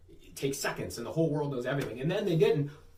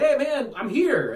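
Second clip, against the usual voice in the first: high, raised-voice greeting; retold calling out; 9 semitones higher, 6 dB louder, slower than usual. Visitor phoning host from the field, calling out. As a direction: announce your arrival loud and high. Retold phone call on arrival.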